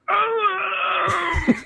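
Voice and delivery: high cry of mock pain; fake pained cry. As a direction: yelp as if falling hard. Fake fall to break instructor.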